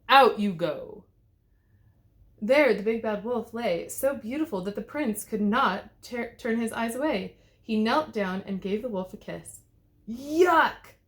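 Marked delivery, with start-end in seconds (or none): 0.1-1.0 snarl. A narrator's reading. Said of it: "he snarled". 10.1-10.9 loud high yuck of disgust; spluttered disgust. A narrator's reading. The wolf kissed by the prince, disgusted splutter. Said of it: "spluttered the big bad wolf".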